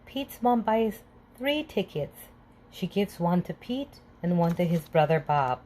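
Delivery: usual speaking voice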